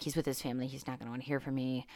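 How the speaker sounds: soft, low self-doubting murmur; inner doubt. Retold or imitated. Thinking about texting a mentor, a soft self-doubting murmur. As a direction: talk yourself out of it quietly. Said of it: "and I was like".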